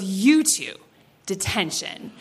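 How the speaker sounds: low, curt sentencing; stern teacher mom. Retold or imitated. Teacher mom whipping around on two students, stern.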